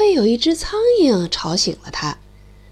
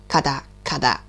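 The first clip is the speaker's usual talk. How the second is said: beak clack; 11 semitones lower than usual. Acted.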